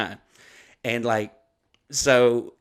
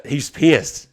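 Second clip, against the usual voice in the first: normal talking voice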